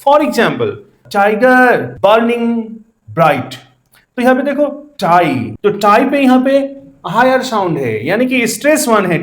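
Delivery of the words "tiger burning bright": slow, separated words with the first syllables leaned on; metrical stress demo. Shown as a demonstration. Teacher shows the class stressed and unstressed syllables, metrical stress demo. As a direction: stress TI of "tiger" and BUR of "burning", then a falling "bright". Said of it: "गर unstress है".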